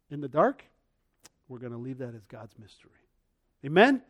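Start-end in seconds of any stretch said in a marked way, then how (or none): none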